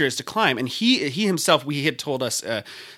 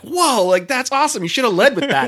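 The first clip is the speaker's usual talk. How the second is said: high, loud mock-amazed reaction; excited retold outburst, pitched up; amazed tourist; 10 semitones higher, 5 dB louder than usual. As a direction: blurt it out like a thrilled tourist.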